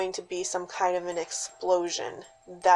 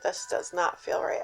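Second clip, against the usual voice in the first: hushed, unvoiced whisper; 2 semitones lower, 7 dB quieter than usual. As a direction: whisper it, spooked. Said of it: "He's like".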